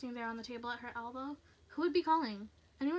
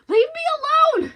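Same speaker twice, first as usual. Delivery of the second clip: very high, drawn-out yell; full-force desperate shout; 21 dB louder, slower than usual. Acted. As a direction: scream it at an intruder outside. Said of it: "Stephanie shouted".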